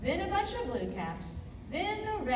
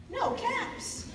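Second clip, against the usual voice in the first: high and startled; dismayed; 13 semitones higher than usual. A narrator's reading. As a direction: gasp it out in disbelief. Peddler searching for caps.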